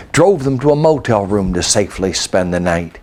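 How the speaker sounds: ordinary delivery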